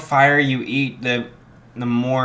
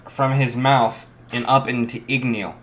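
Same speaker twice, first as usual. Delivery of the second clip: plain reading voice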